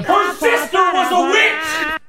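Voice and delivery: shout. Acted. Clip shout.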